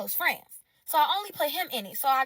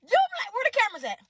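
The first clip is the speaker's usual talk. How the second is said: louder, much higher startled question; loud disbelief; 9 semitones higher, 6 dB louder than usual. Real, not acted. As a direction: look round for hidden cameras. Suspecting a prank.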